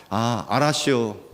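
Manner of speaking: slow, laid-back Chungcheong 알았쇼; 충청도 사투리로 느릿하게; Chungcheong dialect. Retold or imitated. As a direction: drawl it like a Chungcheong elder. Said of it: "충청도 사는 사람만".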